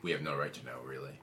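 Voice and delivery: very low, fading mumble. Acted. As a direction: mumble like you're out of it. Tripping character mumbles.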